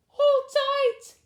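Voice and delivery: high loud call. Acted. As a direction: call across the water. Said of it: "called Blackberry".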